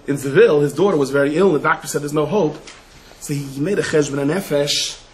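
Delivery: ordinary talk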